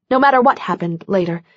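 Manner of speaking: ordinary delivery, nothing marked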